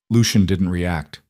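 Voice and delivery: ordinary delivery, nothing marked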